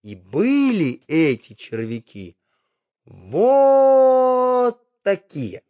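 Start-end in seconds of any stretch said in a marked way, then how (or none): 3.1-5.6 high, slow and stretched; squeaky high. Acted. Showing big worms to child.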